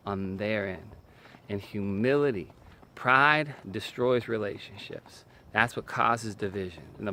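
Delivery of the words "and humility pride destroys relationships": usual speaking voice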